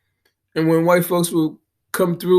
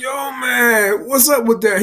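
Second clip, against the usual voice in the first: retold shout; 7 semitones higher than usual. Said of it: "And I'm like".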